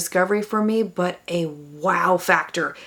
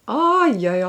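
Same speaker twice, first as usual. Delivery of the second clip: pleased oh yeah; 7 semitones higher, 3 dB louder than usual. Real, not acted. Pleased with highlighter.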